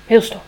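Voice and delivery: loud punch on heel; indignant. Acted. Spring judges the grown-ups, loudly stressing heel. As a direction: spit out the verdict.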